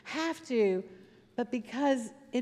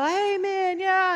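high, raised cheering shout; shouting congregation; 8 semitones higher, 5 dB louder, slower than usual. Retold or imitated. People stand up in church, cheering shout. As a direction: imitate a fired-up church calling back. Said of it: "start shouting and go".

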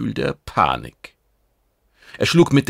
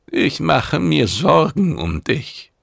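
higher, slower voice for the mentor Urkale; concerned mentor, lifted pitch; Urkale speaking; 7 semitones higher, 4 dB louder, slower than usual. A narrator's reading. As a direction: voice the worried mentor a step up. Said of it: "sagte Urkale".

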